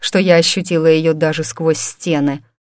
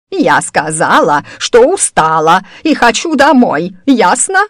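put-on louder, rising old woman's outburst; furious bark; 3 semitones higher, 5 dB louder than usual. A narrator's reading. Old woman demanding to go home, furious bark. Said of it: "рявкнула она с яростью".

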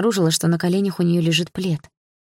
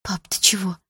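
startled whisper; 2 semitones higher than usual. Acted. Daughter whispering to her father as he fades away. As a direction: breathe it out, alarmed.

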